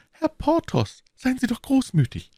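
very high, plaintive woman's voice; anguished high character plea; pleading wife. A narrator's reading. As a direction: beg him to stop tormenting you. Wife begs for mercy.

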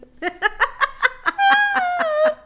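high, loud laughing fit; cracking up hard; shrieking laugh. Real, not acted. Huge laugh at the pun.

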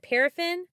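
Paraffin asked with a high questioning rise; unsure pronunciation. Real, not acted. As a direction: Paraffin tried out, pitch jumping up. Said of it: "what is that word?".

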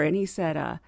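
ordinary delivery

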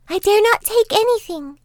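put-on high girlish voice, wary; young girl refusing. A narrator's reading. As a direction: refuse like an obedient child.

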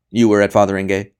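loud, abrupt interrogator; sudden sharp question. A narrator's reading. Interrogator fires a question at the priest, abrupt. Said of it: "The next question came sudden as a shot fired".

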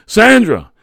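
high, loud, urgent bark; barked name. A narrator's reading. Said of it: "he barked".

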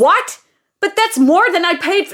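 high, loud outraged disbelief; furious, can't believe it. Acted. Outraged at cancellation fee.

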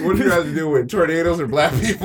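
giggling through the question; laughing while asking. Real, not acted.

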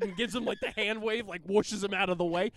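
ordinary talk, nothing marked

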